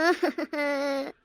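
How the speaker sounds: a quiet, choked sob; soft sob. Acted. Mother sobbing quietly over her heartbroken son. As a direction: let a small sob break out.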